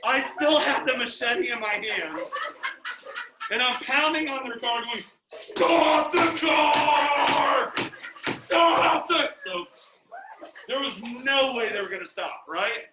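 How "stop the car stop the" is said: very slow, loud bellow; drawn-out yell. Retold or imitated. Retelling himself pounding on their car, drawn-out yell. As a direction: stretch each word into a roar.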